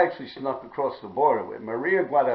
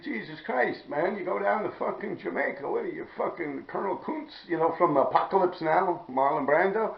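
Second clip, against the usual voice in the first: imitating brother; 7 semitones higher than usual. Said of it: "He's like".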